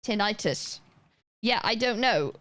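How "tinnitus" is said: "Tinnitus" said tin-EYE-tus; "Tinnitus" as tin-NIGHT-us; long-i pronunciation. Shown as a demonstration. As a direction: give "Tinnitus" a stressed "eye" in the middle. Trying the other pronunciation.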